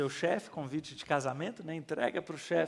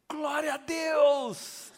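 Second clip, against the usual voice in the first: "Glória a Deus" long, high and slow; exclamação alegre; 10 semitones higher, 5 dB louder, much slower than usual. Retold or imitated. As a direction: celebrate it out loud, drawn out. Pastor reacts to layoff news. (exclamação de júbilo, alta e lenta)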